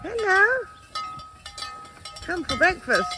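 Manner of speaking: high sing-song pet talk; sweet high voice calling the cows; cooing to cows. Real, not acted. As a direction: coo at the animals like pets. Greeting cows at feeding time.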